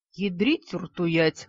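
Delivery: смачно выругался, медленно растягивая; с досадой выругаться, тягуче. A narrator's reading. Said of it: "выругался пухленький". (медленное ругательство с досадой)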